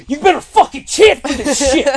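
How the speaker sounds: high, forceful crowd-rousing yell; bully the section into chanting; screamed demand. Retold or imitated. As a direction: aggressive retold shout. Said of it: "I screamed at the top of my lungs".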